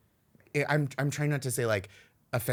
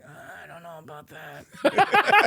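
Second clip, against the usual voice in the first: slow, louder doubtful hedge; doubtful drawl; 4 semitones higher, 5 dB louder, slower than usual. Retold or imitated. Answering campus extremes with slow, skeptical doubt. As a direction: hedge slowly, unconvinced. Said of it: "I'm like".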